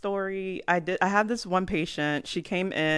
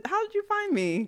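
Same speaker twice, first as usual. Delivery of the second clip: mock surprised parent. Parent voiced hiding snack.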